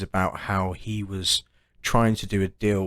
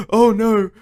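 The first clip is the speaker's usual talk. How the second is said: high, louder gasping cry of a captive; mock panic; 13 semitones higher, 4 dB louder than usual. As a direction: gasp it out like a struggling victim. Acts out man trapped in trunk.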